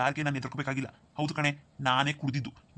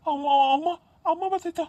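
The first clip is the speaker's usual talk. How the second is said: panicked; 11 semitones higher, 4 dB louder than usual. Acted. Son panics, mother is coming.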